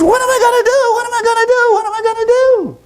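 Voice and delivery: very high squeaky wail; frantic hammed-up monkey squeal; desperate monkey. Monkey asks the owl for help, desperate squeal.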